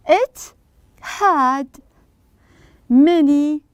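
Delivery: long gaps between single words; slow, deliberate dictation. Shown as a demonstration. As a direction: say each word separately, as if writing it out. Teacher says words while writing them.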